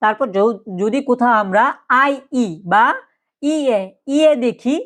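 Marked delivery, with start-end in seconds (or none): none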